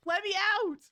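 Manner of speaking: high, loud demand; frustrated shout. Real, not acted. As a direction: yell to be released. Stuck in glitched game, yelling.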